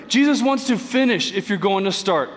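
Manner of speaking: ordinary delivery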